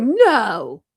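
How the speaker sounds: loud, drawn-out mock-shocked no; re-enacted disbelief. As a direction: stretch it out, acting out disbelief. Acting out her disbelieving reaction.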